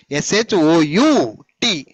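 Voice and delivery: letters spaced out one by one; slow spelling out. Shown as a demonstration. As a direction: spell it slowly for children. Teacher spells word for class.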